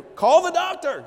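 high, loud, slow yelled order; panicked shout. Retold or imitated. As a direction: bellow it like a frantic husband. Panicked husband yelling instructions.

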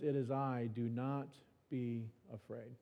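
soft, slowing, fading to near silence; hushed voice of God. Acted. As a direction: let the words fall gently away. Voice of God quoted.

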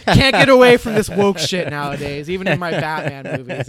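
hammed-up outrage of an offended moviegoer; mock scoffing. Imitating a woman fed up with woke movies, loud and scoffing. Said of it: "she audibly scoffed".

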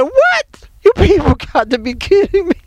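very high, laughing disbelief; shocked, half-laughing can't-believe-it; disbelieving outburst. Retold or imitated. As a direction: squeal it out through laughter. Retold disbelief at revelation.

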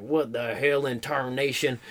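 low, slow, quiet cowboy grumble; mild cowboy grumble. Retold or imitated. Cowboy seeing his first cars, mildly baffled grumble. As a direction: grumble softly as a baffled old-time cowboy.

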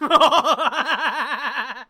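high, slow laugh running into words; amused, laughing remark. Real, not acted. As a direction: talk through a real laugh. Laughing at the cleaner punchline.

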